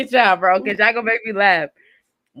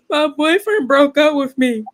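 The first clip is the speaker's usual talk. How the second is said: mock sobbing; 6 semitones higher than usual. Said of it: "crying in a random lady's arm saying".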